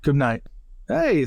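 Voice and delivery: normal talking voice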